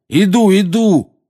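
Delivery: high, loud, drawn-out cry; exasperated loud surrender; shouting. A narrator's reading. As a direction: yell it while fending off blows. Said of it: "крикнул наземникус".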